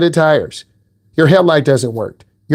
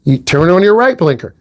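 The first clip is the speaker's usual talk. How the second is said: normal talking voice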